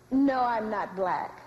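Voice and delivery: low, drawn-out denial; slow and low, disowning it; firm denial. Acted.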